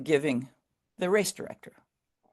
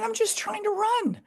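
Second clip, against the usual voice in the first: much higher, small timid voice; meek reply. Retold or imitated. Young runner answering the pushy press, high meek reply. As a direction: answer like a shy twenty-year-old.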